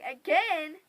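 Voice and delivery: loud, incredulous question; shocked echo. Retold or imitated. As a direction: throw the word back loudly in disbelief.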